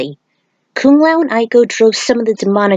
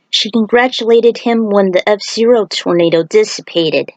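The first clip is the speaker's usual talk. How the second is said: ordinary delivery, nothing marked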